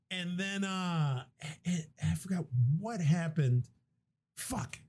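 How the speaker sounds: normal talking voice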